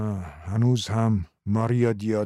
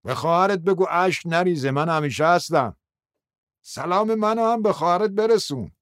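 high-pitched shout from afar; 8 semitones higher than usual. A narrator's reading. Someone calling a parting message for a sister, shouted high. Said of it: "فریاد زد".

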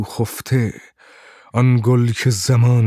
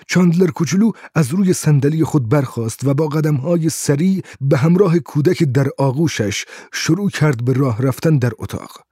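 ordinary delivery, nothing marked

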